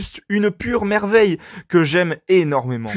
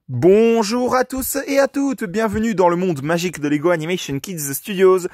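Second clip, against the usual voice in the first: announcer intro; 4 semitones higher than usual. Acted.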